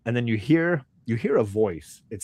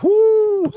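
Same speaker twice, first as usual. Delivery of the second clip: high, loud drawn-out whoo; whistle-high cry of caution; alarmed whoo; 11 dB louder than usual. Acted. Merchant wary of dangerous arrowhead.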